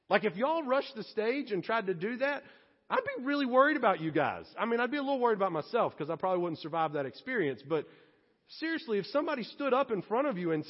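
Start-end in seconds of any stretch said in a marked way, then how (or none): none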